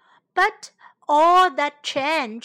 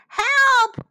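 high, loud yell of alarm; crying for help; 10 semitones higher, 8 dB louder than usual. A narrator's reading. Birds to all as developers arrive, crying out. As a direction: shout urgently at the top of the range. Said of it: "cried the birds".

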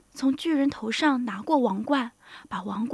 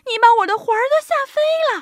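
high, loud, quick squeal of fright; hammed-up fright, pitched way up; old woman crying out; 11 semitones higher, 10 dB louder, much faster than usual. A narrator's reading. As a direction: shriek fast as a startled little old lady. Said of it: "瘦小的老妇人喊起来".

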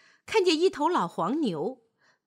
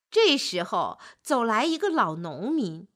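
plain reading voice

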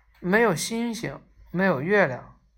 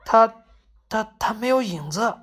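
raised, halting stutter on the first word; alarmed stammer; 3 semitones higher than usual. A narrator's reading. Vendor points at the shadowless man, alarmed stammer. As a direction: stammer it out, taken aback. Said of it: "结结巴巴地说".

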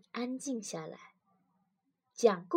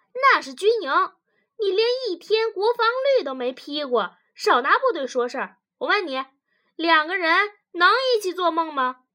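loud, fast, higher challenge; scolding rebuttal; 7 semitones higher, 8 dB louder, faster than usual. Acted. Wife rejects husband's army example, scolding. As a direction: cut the argument down loudly.

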